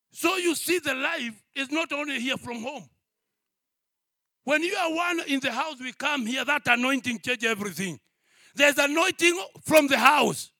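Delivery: loud, driving sermon delivery; full-force preaching. Acted. Pastor to congregation, loud fervent preaching.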